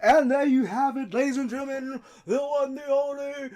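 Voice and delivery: booming wrestling announcer, stretching every title; big arena announcer voice rising to a bellow; ring announcer intro. Acted. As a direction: build the hype to a roaring climax. Announcer outro.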